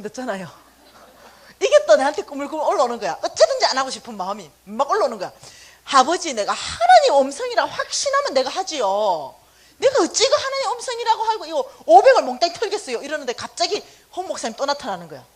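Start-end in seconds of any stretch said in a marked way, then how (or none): 5.8-13.0 louder, higher pleading complaint; whiny objection. Retold or imitated. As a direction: protest to the Father that it's too much.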